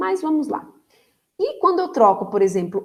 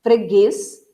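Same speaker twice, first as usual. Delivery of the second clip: Freguês with guês louder and rising; stress on last syllable; 6 dB louder than usual. Shown as a demonstration. Teacher pointing out the tonic syllable, Freguês punched on guês. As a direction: lean hard on guês in Freguês. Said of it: "a sílaba tônica está no".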